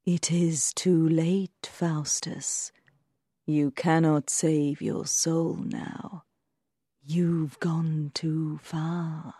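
slow, hushed bad-angel whisper; sinister, drawn-out whisper; soft whisper. A narrator's reading. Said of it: "whispered softly".

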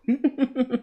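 high, jeering laugh; mocking laugh. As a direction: laugh at the trapped man. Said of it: "几个打手是哈哈大笑". (嘲弄的大笑)

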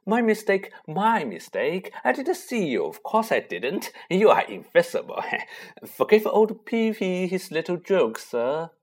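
oily high fawning; greasy groveling. Acted. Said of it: "he said greasily".

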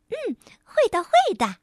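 high, sly agreeing; grinning elf. Acted. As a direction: grin through it, amused. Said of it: "咧嘴笑了笑". (咧嘴笑着答应)

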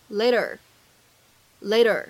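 "later" with the t as a quick American tap; flap T. Shown as a demonstration. Teacher explaining American pronunciation, "later" with a flapped t. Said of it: "它是一个典型的flap T".

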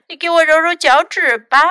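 high, loud little-one voice; small child's voice. A narrator's reading. Hurt Louis orders Fox about, high small voice. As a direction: make demands in a high small voice.

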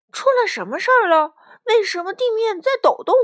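high, loud startled questions; alarmed questioning. Acted. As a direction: ask in rising alarm. Alarm at the shaking ground. (惊慌地高声发问)

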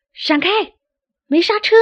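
high, loud warning yell; shouting down. Acted. Berio, crashing in on the birds below, yelling a warning, panicked shout. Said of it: "贝里奥朝下大喊".

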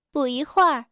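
usual speaking voice

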